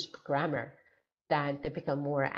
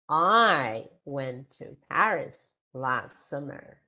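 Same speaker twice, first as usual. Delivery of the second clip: I stretched long and loud, then slow and lower; stress on I; 4 semitones lower, 5 dB louder, slower than usual. Shown as a demonstration. Answering who went to Paris, stress on I. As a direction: lean hard on the first word, I. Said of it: "So stress word in a sentence".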